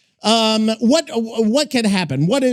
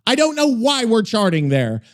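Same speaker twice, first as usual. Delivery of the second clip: ordinary talk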